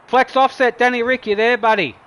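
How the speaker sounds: loud, high call to the drivers. Real, not acted. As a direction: call them into the chat, loud and bright. Host calling drivers into chat.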